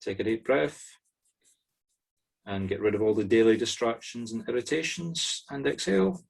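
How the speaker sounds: usual speaking voice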